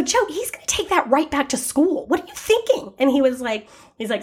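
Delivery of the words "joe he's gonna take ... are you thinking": high, reproachful scold; exasperated scolding. Retold or imitated. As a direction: tell off the husband. Mom scolding husband.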